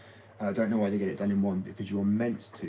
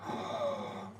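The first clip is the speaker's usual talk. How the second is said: gasp; 6 dB quieter than usual. Retold or imitated. People react to the word.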